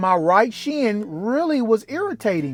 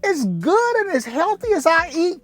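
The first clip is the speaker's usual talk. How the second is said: shocked disbelief; 8 semitones higher than usual. Retold or imitated. A man hears he may have a blood clot, squealing in high disbelief. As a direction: act out refusing to believe the news.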